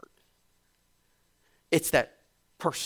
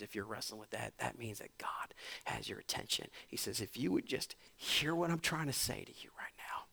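hushed preaching; 2 semitones lower, 5 dB quieter than usual. Acted. Hushed intimate preaching passage.

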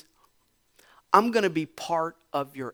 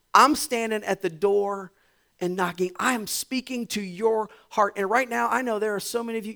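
higher, louder fervent appeal; impassioned preaching; 6 semitones higher, 6 dB louder than usual. Acted. Pastor urging wrestling listeners to hear God, fervent. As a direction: press the plea on the listeners.

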